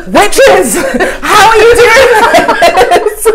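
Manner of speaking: high, loud, laughing and slowed; mock awed accusation. To the Melbourne baristas, mock accusing them of witchcraft, high and laughing. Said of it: "and I was like".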